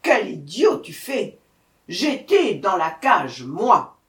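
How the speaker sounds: loud, forceful scolding; exasperated tiger. A narrator's reading. Tiger correcting the muddled jackal, exasperated. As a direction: correct him hard and loud.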